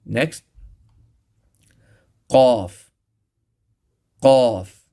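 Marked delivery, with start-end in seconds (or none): 2.3-4.8 "Qaf" from the uvula, much louder; deep qaf. Shown as a demonstration. Teacher announcing the letter qaf. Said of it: "extreme back part of the tongue".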